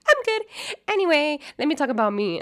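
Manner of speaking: high, loud mocking imitation; mock self-absorbed Amber. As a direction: imitate someone rudely steering talk to herself. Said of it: "Amber does not have any manners. She's like".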